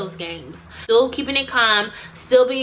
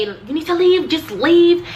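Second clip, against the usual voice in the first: high, louder imitation of the receptionist; retelling the receptionist's raised demand to leave; mock yelled order; 7 semitones higher, 5 dB louder than usual. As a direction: push her out the door with your voice. Receptionist voiced ordering her out.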